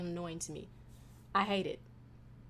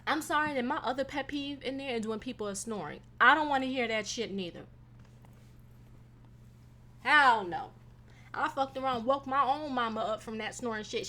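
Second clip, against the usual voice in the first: usual speaking voice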